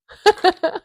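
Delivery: loud, high burst of laughter. Real, not acted. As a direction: break into a big laugh at the sign-off. Laughs at end of video.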